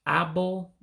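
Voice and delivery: ordinary talk, nothing marked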